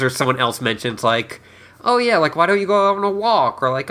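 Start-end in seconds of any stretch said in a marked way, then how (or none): 1.8-3.6 higher, lilting casual suggestion; imitated breezy suggestion. Someone casually suggesting a walk as the door opens, mock breezy. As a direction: toss out a friendly idea in a raised pitch.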